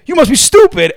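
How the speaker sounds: high, loud imitated kid; mocking kid. Gamer kid taunting a thirteen-year-old, mock scornful. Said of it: "they sound young".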